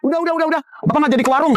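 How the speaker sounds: high-pitched giving up; exasperated refusal. Acted. Father overwhelmed by snack order, high exasperation. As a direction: cut off an endless request.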